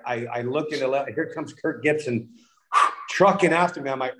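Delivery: usual speaking voice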